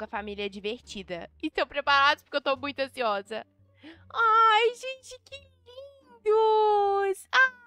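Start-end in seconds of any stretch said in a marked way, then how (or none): 4.1-7.5 high, loud delighted squeal; shrieking with joy at the cute family; excited squeal. Pretend. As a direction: gush over the new family like a thrilled kid. Meeting the fun new family.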